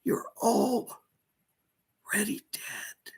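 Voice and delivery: high whispered panic, breaking into cries; pleading in terror; frightened, near tears. Pretend. Terrified retelling of being chased.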